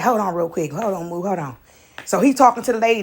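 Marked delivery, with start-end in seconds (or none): 0.0-1.6 lower, softer, hurried mutter; dad brushing her off. Retold or imitated. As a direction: wave your kid off on the phone. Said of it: "he said".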